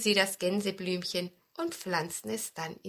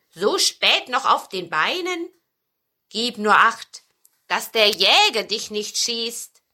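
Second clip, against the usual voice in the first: Fuchs; 8 dB louder than usual. A narrator's reading. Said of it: "sagte der Fuchs".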